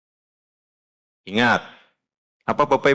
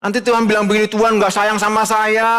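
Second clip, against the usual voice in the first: high, loud, urgent preacher's exhortation; fiery preaching; 10 semitones higher, 4 dB louder than usual. Real, not acted. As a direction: drive it home from the pulpit. Preaching at full force. (khotbah berapi-api)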